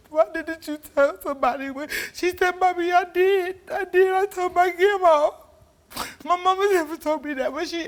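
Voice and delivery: tearful, high pleading. Real, not acted. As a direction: voice pitched high with distress. Distressed retelling, high pitch.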